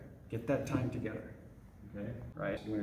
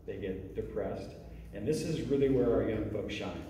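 normal talking voice

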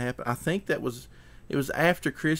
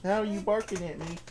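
higher, sing-song pet talk; talking to the dog; 9 semitones higher than usual. Real, not acted. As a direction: coax the dog in a high voice.

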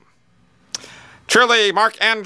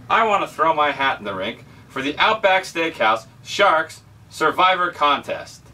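usual speaking voice

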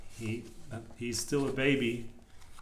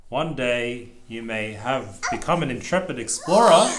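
wicked snake hiss; 9 dB louder than usual. Acted. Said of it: "the cake hissed wickedly".

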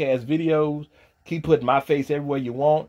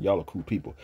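ordinary talk, nothing marked

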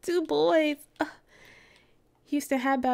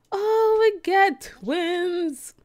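high, slow, stretched gush; delighted; 7 semitones higher, 3 dB louder, much slower than usual. Real, not acted. Gamer telling viewers about her twins, slow and high. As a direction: savour the words.

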